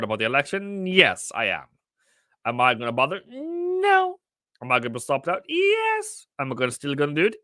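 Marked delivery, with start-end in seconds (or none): 3.3-4.2 a sudden squeaky falsetto no, much higher; silly squeaky voice. Pretend. Trader answering his own question in a silly high squeak. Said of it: "Why am I talking like that". 5.5-6.3 a sudden squeaky falsetto no, much higher; silly squeaky voice. Pretend. Trader answering his own question in a silly high squeak. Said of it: "Why am I talking like that".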